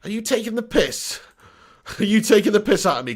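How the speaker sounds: usual speaking voice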